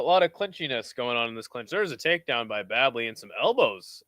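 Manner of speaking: ordinary delivery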